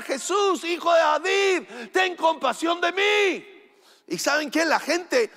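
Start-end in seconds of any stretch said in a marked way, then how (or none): 0.0-3.5 high-pitched pleading cry; grito suplicante. Retold or imitated. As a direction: cry out like a blind beggar calling for mercy. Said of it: "él empieza a gritar". (grito agudo de súplica)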